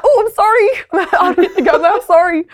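hammed-up guilty apology; apologetic squeal. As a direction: apologise in a comic wail, half laughing. Acts out apologising while sparring.

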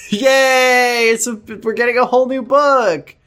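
high, drawn-out celebratory whoop; mock cheering. Co-host celebrating a whole new book, mock excitement.

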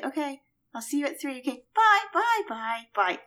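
rising string of quick byes; British goodbye. Shown as a demonstration. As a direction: trail off repeating bye. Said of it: "you have to say it like this".

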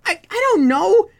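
high kobold shifting the blame; nervous kobold. Acted. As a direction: stammer and pin it on Larry. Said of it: "Another one just sort of goes".

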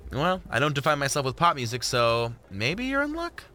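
Jordan, flirty. Acted. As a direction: drop a playful hint, stretching the last words.

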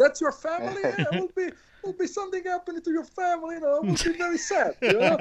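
high, quick, laughing menace; a tongue-in-cheek gangster threat; mock mob threat. Joking that a mobster threatens the photographer's family, laughing.

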